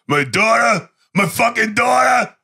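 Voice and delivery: high, building roar of a wronged father; acted-out vengeful father's shout; retold enraged cry. Sly beating Nico over his daughter, enraged cry. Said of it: "Sly is going".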